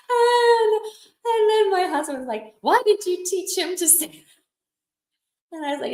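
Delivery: high, laughing through the words; can barely get it out for laughing; giggling while telling it. Real, not acted. Husband quoted while crying-laughing.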